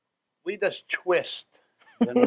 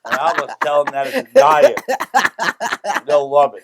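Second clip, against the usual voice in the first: laughing; 5 semitones higher, 10 dB louder than usual. Real, not acted. Joking about fat friends.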